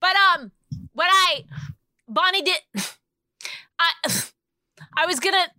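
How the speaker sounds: high, halting voice that never finishes a sentence; mocking her cohost trying to talk; impression of Rich. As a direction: keep starting and stopping, never get a word in. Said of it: "doing an impression of you".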